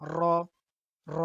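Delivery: র said thick and heavy; heavy Arabic র. Shown as a demonstration. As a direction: make র full and thick. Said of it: "মোটা করে বলবো".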